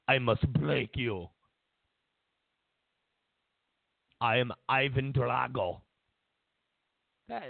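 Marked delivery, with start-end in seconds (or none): 0.1-5.8 low, slow Russian-accented threat; menacing Russian movie villain accent. Shown as a demonstration. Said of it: "only good Russian accent I know".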